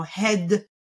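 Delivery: "head" as slow head-uh; intrusive schwa. Shown as a demonstration. Therapist imitating a child she evaluated, schwa added in "head". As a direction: let the d of "head" release into an uh. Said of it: "he's adding in the uh sound".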